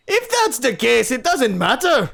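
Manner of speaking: loud, high, slow attempt at Scottish; booming stab at a Scottish accent. Old wolf sniffing the room, attempted Scottish accent. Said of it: "give him a Scottish accent. I'll try".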